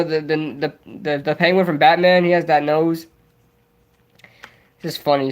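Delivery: ordinary talk, nothing marked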